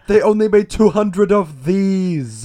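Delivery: loud, high, drawn-out lament; mock freak-out. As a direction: parody a distraught car owner, stretching words. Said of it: "he's freaking out a bit more".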